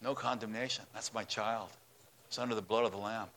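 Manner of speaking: low, soft, hushed assurance; God quietly claiming his child. Acted. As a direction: speak it softly, nearly under the breath. God answering the accuser again.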